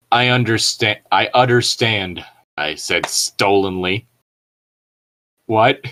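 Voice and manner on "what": ordinary delivery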